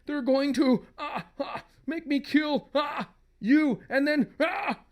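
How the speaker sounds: high tormented voice with pained cries; agonized husband. Acted. Possessed husband warns wife, high agony. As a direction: gasp out the warning between cries of agony.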